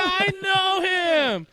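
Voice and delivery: excited shout. Retold or imitated. Acting out Buddy yelling about Santa, high and loud. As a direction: shout it like an overjoyed kid. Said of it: "And he's like".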